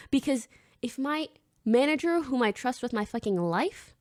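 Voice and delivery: ordinary talk, nothing marked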